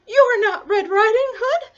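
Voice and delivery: high, loud disbelief; alarmed grandmother. Acted. Grandmother seeing it is not her granddaughter, high alarm. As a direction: blurt it out in startled realisation.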